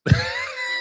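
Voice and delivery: high squeal of real laughter; helpless high-pitched laugh. Real, not acted. Laughing at own mockery.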